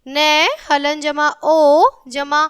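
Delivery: slow, syllable by syllable; slow spelling out. Shown as a demonstration. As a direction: spell it out slowly for children. Teacher spells out letter by letter. (धीरे-धीरे अक्षर जोड़कर बोलना)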